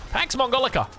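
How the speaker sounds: normal talking voice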